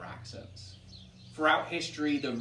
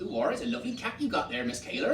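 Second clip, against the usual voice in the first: high, quick rural West Country lilt; farmer's West Country accent, without the pirate act; 10 semitones higher, 3 dB louder, faster than usual. Shown as a demonstration. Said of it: "didn't say it in a pirate accent".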